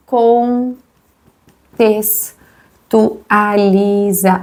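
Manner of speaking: CONTEXTUALIZA very slow and low, pulled apart; slow syllable-by-syllable. Real, not acted. Teacher writing the word out, CONTEXTUALIZA said slowly in pieces. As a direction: dictate CONTEXTUALIZA to yourself in long, separated syllables.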